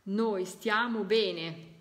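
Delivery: slow, weight on noi; slow repeat. Shown as a demonstration. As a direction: spell the message out gently. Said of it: "Vedete quando i messaggi". (lento, accento su noi)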